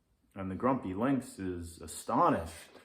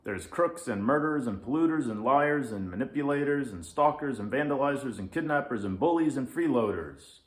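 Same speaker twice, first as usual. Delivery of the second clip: fast, louder rattled-off list; emphatic; 4 semitones higher, 5 dB louder, faster than usual. Acted. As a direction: hammer every item home.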